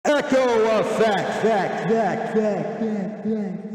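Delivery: loud, slow words echoing off into the distance; echo effect. Shown as a demonstration. Testing a new mic echo on his vlog, slow, echoing call. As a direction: call out "Echo effect" and let each "effect" ring back fainter. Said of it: "I wanted to try this echo effect".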